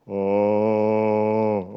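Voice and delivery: low, sustained big-voiced "ah"; big deep "ah". Shown as a demonstration. Teacher showing students the biggest A possible, deep big voice. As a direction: drop low and hold one fat vowel. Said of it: "le plus gros A que vous pouvez".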